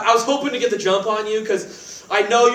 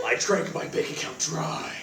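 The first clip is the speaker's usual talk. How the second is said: low hushed brooding killer voice; assassin's voice; 6 semitones lower, 9 dB quieter than usual. Acted. [name] tells roommate Cody about the call in his assassin voice, low and hushed. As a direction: mutter it like a grim movie assassin. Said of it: "it's my assassin's voice".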